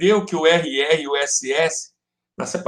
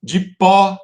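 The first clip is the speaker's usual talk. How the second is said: "De pó" punched louder, landing on PÓ; syllable-count demo; 3 semitones higher, 5 dB louder than usual. Shown as a demonstration. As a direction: sound out "De pó" with a strong final beat. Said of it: "uma sílaba".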